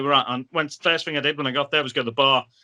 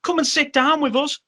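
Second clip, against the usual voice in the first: much higher, put-on voice of a welcoming wrestler; friendly invite; 12 semitones higher than usual. Gangrel inviting the narrator to sit and chat, high put-on voice. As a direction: sing it out like a big friendly host. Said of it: "And he said".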